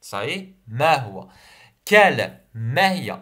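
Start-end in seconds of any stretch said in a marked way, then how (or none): 1.9-2.4 quel said loud and falling, spelling it out; teacher's stressed quel. Shown as a demonstration. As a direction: hit quel hard for dictation. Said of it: "Avec deux L".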